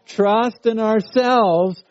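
slow, emphatic group reading; congregational recitation. Real, not acted. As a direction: lead the congregation in reciting the verse. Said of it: "let's say it together".

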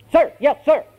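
high, barked recruit reply; drilled recruit. Acted. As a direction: snap back an instant answer to the sergeant. Recruit answers instantly.

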